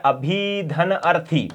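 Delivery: अभिधन अर्थी said slowly, parts apart; slow breakdown. Shown as a demonstration. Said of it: "अभ्यर्थी बनता है". (अभिधन अर्थी को धीरे-धीरे तोड़कर बोलना)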